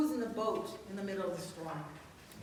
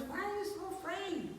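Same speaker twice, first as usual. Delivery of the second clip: gentle rebuke; 7 semitones higher than usual. Retold or imitated. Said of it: "He says".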